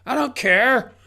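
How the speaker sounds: high, loud dismissal; sharp retort. Real, not acted. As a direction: cut the excuse off sharply. Brushing off the excuse.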